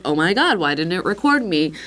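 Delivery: ordinary talk, nothing marked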